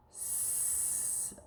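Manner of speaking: "S" as a long, soft hiss; isolated last sound. Shown as a demonstration. Teacher showing pupil the last sound, long hissed "S". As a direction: hold only the "sss" of "S". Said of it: "What's that last sound?".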